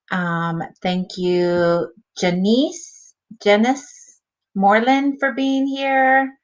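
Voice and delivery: ordinary talk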